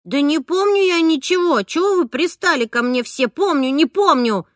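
put-on loud, high child's outburst; girl shouting back at grown-ups; shouted. A narrator's reading. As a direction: yell it out, fed up and cornered. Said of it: "выкрикнула Дана".

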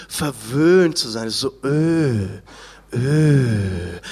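low, slow, drawn-out indulgent Ö; mock pampered Ö. Shown as a demonstration. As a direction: savour the Ö like a pampered diner. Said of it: "das Ö von verwöhnt".

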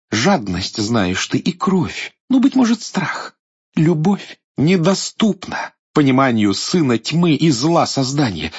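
high, proud princess voice; a princess defying the tyrant; defiant princess. Acted. Царевна гордо отвечает идолу. (гордая царевна)

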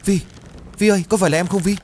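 high, loud desperate cry; anguished shout. Acted. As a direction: call out to her in despair. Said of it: "gào lên".

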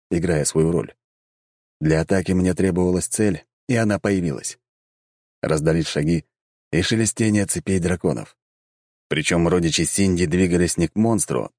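plain reading voice

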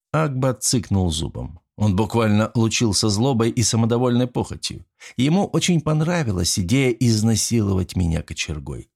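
plain reading voice

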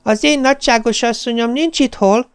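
high, pleased sing-song recital; smug recitation. Retold or imitated. Parrot proudly recites its line, high and pleased. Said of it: "szavalta elégedetten".

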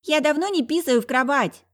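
put-on high indignant little boy; protest, deeply insulted; offended child. A narrator's reading. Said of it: "оскорблённо заявил Мишка".

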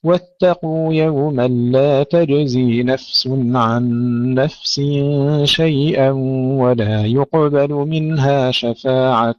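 slow, measured tajwid chanting; drawn-out recitation with careful articulation; Quran recitation. Acted. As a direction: recite slowly with long held vowels.

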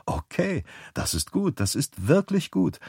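gentle warmth, lifted and hushed; warm, wide-eyed. A narrator's reading. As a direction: gush softly with wide-eyed approval. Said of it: "sagt Davis warm und mit großen Augen".

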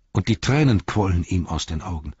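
ordinary delivery, nothing marked

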